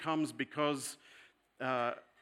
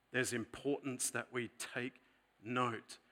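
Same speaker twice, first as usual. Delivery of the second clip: ordinary delivery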